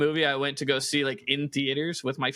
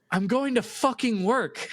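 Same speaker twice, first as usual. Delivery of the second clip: higher, exasperated dad retort; fed-up dad; 7 semitones higher than usual. Retold or imitated. Dad answering his clueless son, higher exasperated retort. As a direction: answer with weary disbelief. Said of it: "He goes".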